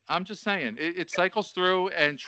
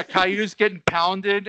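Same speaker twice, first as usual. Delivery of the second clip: normal talking voice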